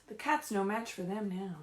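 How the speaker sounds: lively, sing-song picture-book reading; animated storybook voice with questions to the kids; reading to children. Acted. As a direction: read it like story time for little ones. Teacher reads picture book aloud.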